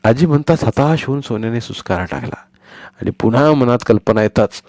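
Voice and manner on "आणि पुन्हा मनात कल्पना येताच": usual speaking voice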